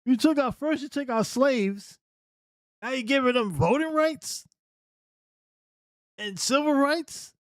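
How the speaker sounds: high, indignant caricature of an angry Southerner; voicing angry segregationists, hammed-up indignation; mock outraged Southerner. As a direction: lampoon their outrage, pitched well up. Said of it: "They're like".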